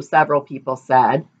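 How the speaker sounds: usual speaking voice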